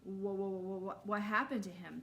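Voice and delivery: mild stammering whoa; a touch of nervous stutter; scared kid stumbling over words; stammered. Acted. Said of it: "Jasper stammered".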